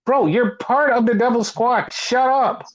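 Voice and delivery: high-pitched, incredulous comeback; exasperated retort. Acted. [name]'s mock retort to Wardlow.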